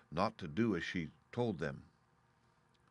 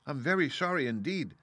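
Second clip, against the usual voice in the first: frightened apology; 6 semitones higher than usual. Acted. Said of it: "truly frightened".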